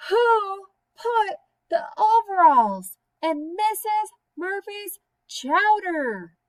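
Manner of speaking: high, slow, loud wailing shout; shouting herself hoarse for an answer; loudest shout. Acted. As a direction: bellow it out at full force. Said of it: "So she said it all the louder".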